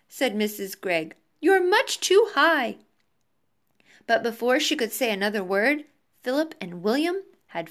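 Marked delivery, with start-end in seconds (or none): 1.4-2.8 high, urgent warning; alarmed scolding. A narrator's reading. Mother to sons flying too high, high alarmed warning. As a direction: warn them in a motherly panic.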